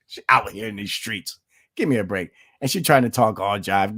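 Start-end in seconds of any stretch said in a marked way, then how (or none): none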